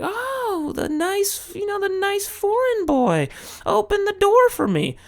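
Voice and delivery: very high, breathy mock female voice; Japanese woman. A foreigner imitating a Japanese woman praising him. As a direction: squeal it like an admiring Japanese woman. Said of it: "and they're like".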